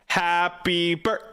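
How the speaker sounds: choppy, cut-off words like bad lag; laggy voice. Shown as a demonstration. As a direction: break it up like a lagging stream. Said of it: "Happy birthday with lag".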